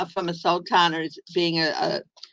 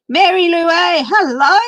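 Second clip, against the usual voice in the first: excited greeting; 10 semitones higher, 6 dB louder than usual. Real, not acted. Greeting a viewer by name.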